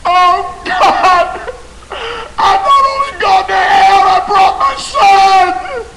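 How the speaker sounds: very high, loud wail of horror; anguished scream. Acted. Father in hell finding his son, anguished screaming. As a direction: scream it in despair, dragging out the last words.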